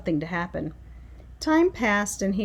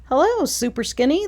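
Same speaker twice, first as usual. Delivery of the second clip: higher and louder teasing call-out; mocking shout; 5 semitones higher, 4 dB louder than usual. A narrator's reading. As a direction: jeer at him across the street. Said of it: "they would shout".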